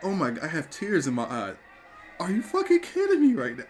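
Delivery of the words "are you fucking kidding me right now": much higher, incredulous outburst; can't believe what you just saw; shocked disbelief. Real, not acted. Fan watching a wrestling show live, pitched way up in disbelief.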